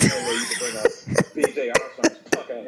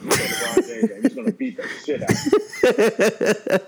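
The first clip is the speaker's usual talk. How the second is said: high, louder, laughing through it; laughing threat; 10 semitones higher, 8 dB louder than usual. Retold or imitated. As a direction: crack up while warning. Jordan rage scenario, laughing.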